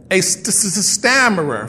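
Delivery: a gentle demo of Moses stuttering; imitating a stutter. Shown as a demonstration. Pastor showing the church how Moses talked, stuttering. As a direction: stammer on the first sound, st-, st-. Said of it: "that's how he talked".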